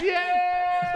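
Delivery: loud high cheer; excited shout. Real, not acted. Celebrating finished list.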